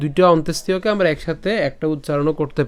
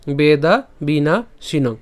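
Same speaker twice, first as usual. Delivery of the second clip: three slow, separate Sanskrit words; pronunciation demo; slower than usual. Shown as a demonstration. Teacher repeats the first three parts, slow demonstration. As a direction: model Vedha Veena শিনং unhurriedly, part by part. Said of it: "এই প্রথম তিনটি অংশ".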